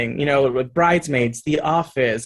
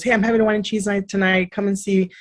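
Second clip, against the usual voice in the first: friend inviting; 6 semitones higher than usual. Retold or imitated.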